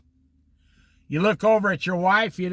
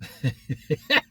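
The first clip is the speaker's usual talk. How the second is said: a rising giggle he cannot hold back; helpless laugh; 7 semitones lower than usual. Real, not acted. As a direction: laugh in spite of yourself. Can't stop laughing.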